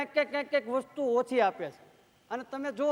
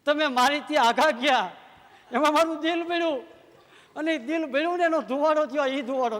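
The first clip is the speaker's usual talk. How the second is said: higher, mildly theatrical Sita lament; a hint of a grieving heroine on stage; lightly played Sita; 3 semitones higher, 4 dB louder than usual. Playing Sita answering Ram. (Sita બનેલો કલાકાર રામને નાટકીય રીતે જવાબ આપે છે)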